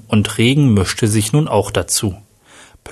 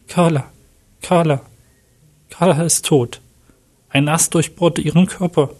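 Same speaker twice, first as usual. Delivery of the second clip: understated shock, higher-pitched and halting; stunned, with pauses between the first phrases; 6 semitones higher than usual. A narrator's reading. Manuel reports Carla's death on the phone, in understated shock. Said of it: "antwortete Manuel unter Schock".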